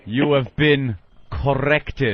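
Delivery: slow, deliberate pronouncement; drawn-out action-hero line; cool catchphrase. Acted. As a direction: deliver it like a measured tough-guy one-liner. Delivers the catchphrase as tough gunman.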